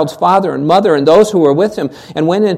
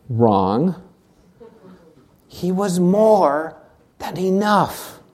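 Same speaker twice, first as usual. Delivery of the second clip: soft, slow, weighty; hushed certainty; 5 dB quieter, much slower than usual. Real, not acted. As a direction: let the truth land quietly. Preacher's triumphant answer.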